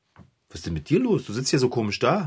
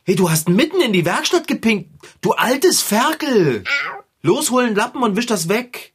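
scolding; 7 semitones higher than usual. Acted. Figarino schimpft mit dem Kater über die Pfütze, empört. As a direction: scold the naughty cat, slowing on the insult.

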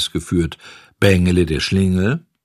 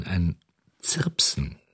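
whispered; 6 dB quieter, slower than usual. A narrator's reading. Narrator mimics a faint chirp.

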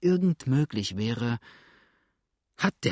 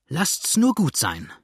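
a slow, raised, cross dismissal; irritated; 5 semitones higher, 3 dB louder, slower than usual. A narrator's reading. The still irritated widow brushes off Blackfoot's question, crossly. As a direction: cut him off with annoyance. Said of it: "zürnte die noch immer gereizte Dame".

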